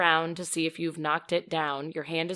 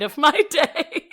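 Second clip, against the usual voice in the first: higher, louder, slow, breaking into laughter; laughing; 9 semitones higher, 9 dB louder, slower than usual. Real, not acted. Host reading listener's postscript to co-host, laughing. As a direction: let laughter lift the end of the quote.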